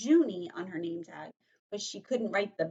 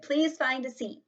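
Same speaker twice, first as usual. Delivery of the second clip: higher, firm classroom call; brisk raised order; teacher's command; 6 semitones higher, 4 dB louder than usual. Acted. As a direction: tell the class to sit, voice raised. Teacher calls the class to sit.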